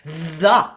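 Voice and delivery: "The" with a buzzing voiced th. Shown as a demonstration. Coach models voiced th in the. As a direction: buzz the th of "The". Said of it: "Voice T H".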